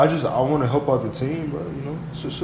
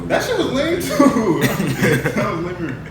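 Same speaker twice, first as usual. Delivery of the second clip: laughing through the words; can't stop laughing; 7 semitones higher, 6 dB louder than usual. Real, not acted. Laughing at failed intro.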